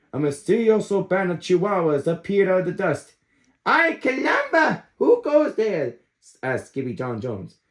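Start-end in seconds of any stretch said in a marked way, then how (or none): 3.6-6.0 high startled Spanish exclaim; startled cry. Acted. Puppy startled by strange band, high and surprised.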